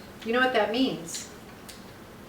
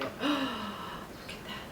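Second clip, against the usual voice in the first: awed whisper; 2 semitones higher, 12 dB quieter, slower than usual. Real, not acted. Awed by lobster roe.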